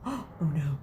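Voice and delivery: a sudden gasp of dread; gasp of alarm. Real, not acted. Narrator gasps at runaway broom.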